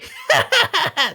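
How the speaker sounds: high-pitched mock laugh; high, put-on cackle; imitated villain laugh. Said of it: "So he's like".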